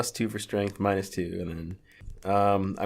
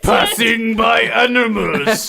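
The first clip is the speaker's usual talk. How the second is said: building comic list; 10 semitones higher, 5 dB louder, slower than usual. Acted. Escalating list of handshake partners.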